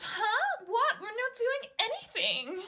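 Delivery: high, fake-innocent squeak; mock innocent. Bully girl feigning innocence to the classmate. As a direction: play dumb in a high voice.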